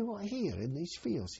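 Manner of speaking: high and slow, incredulous; retold disbelief. To his pals about the weighing, high slow disbelief.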